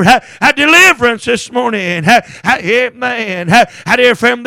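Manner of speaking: normal talking voice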